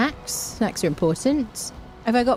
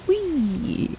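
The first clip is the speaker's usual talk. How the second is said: whee; 9 semitones higher than usual. Real, not acted. Sliding down in game.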